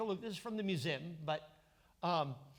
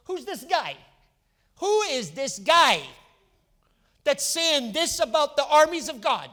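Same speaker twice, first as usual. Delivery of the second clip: higher, louder, slowing down on "who is this guy"; a young shepherd swelling with outrage; building outrage; 7 semitones higher, 9 dB louder than usual. Retold or imitated. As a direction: draw it out, getting more indignant each phrase. David's outrage repeated, building.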